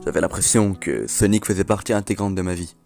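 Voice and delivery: eerie low story ending; ominous narration. A narrator's reading. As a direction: tell it as a haunted survivor's last words. Closing creepypasta narration.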